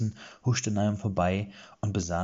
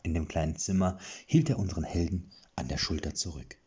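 plain reading voice

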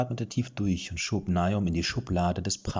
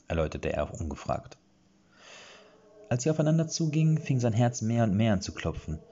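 ordinary delivery

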